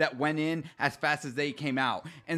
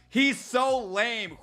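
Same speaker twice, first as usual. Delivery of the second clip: laughing; 8 semitones higher, much slower than usual. Real, not acted.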